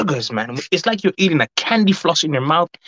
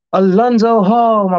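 excited shout-out; 9 semitones higher, 7 dB louder than usual. Real, not acted. Greeting a chat member by name.

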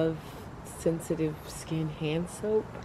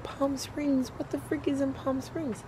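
higher, disbelieving outburst; incredulous; 6 semitones higher than usual. Retold or imitated. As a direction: echo it in total disbelief. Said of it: "I'm like".

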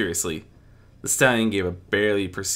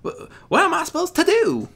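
panicked; 7 dB louder than usual. A narrator's reading. Applejack panics.

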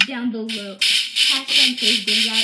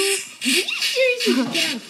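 laughing disbelief; 10 semitones higher, slower than usual. Real, not acted. Disbelief while laughing in game.

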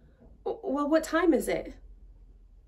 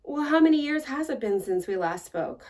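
usual speaking voice

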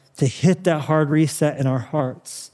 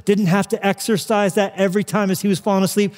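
ordinary talk

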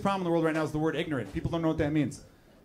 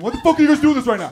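loud, fast, high yell; frantic exasperated shout, rushed; freaking out; 7 semitones higher, 11 dB louder, faster than usual. Retold or imitated. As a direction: imitate a sound guy losing it. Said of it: "He's probably freaking out going".

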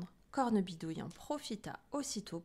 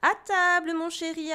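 high, loud, sing-out call; raised motherly holler; 8 semitones higher, 11 dB louder than usual. A narrator's reading. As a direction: call the child to the table from afar. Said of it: "appela sa maman".

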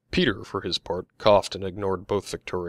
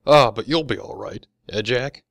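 teasing; 3 semitones higher, 8 dB louder than usual. A narrator's reading. Young man needles Jack.

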